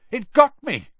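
loud, high-pitched outcry; yelping after being hurt; pained yell. A narrator's reading. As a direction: yell it out in alarm. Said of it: "yelled Dean Thomas".

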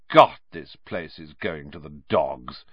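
scornful. A narrator's reading. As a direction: sneer meant to be overheard. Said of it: "said Malfoy loudly".

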